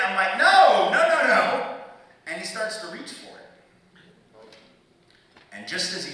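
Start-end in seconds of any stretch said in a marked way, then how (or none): 0.3-1.8 high, loud, frantic no; hammed-up panic, shouted high; panicked denial. Cop reaches for the fake ID, panicked refusal.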